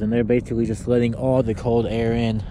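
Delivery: normal talking voice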